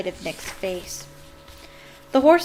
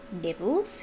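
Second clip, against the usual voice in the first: sly sweet offer, rising at the end; 4 dB quieter than usual. A narrator's reading. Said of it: "he said sweetly".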